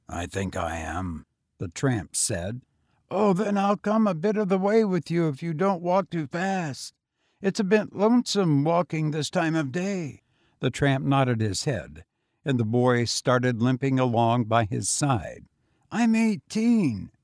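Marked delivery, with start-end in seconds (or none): 0.1-1.3 low, weary tramp; lower, tired vagrant voice. A narrator's reading. Tramp answers. 3.1-10.2 put-on higher, eager boy voice; young boy. A narrator's reading. Boy speaks. 15.9-17.1 put-on high, slow, offhand boy; casual. A narrator's reading. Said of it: "he said casually".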